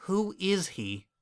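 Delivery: cautious suspicion. A narrator's reading. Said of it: "Suspicion was evident in her voice".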